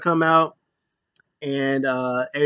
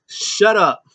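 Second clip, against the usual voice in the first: dismissive snap; 4 semitones higher, 4 dB louder than usual. Retold or imitated. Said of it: "He said".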